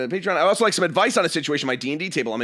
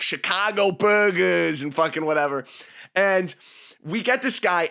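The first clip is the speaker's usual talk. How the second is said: ordinary delivery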